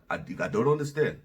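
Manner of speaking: low-pitched imitation of an African accent; attempted African accent. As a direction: have a go at an African man's accent. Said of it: "I'm gonna try to do his accent".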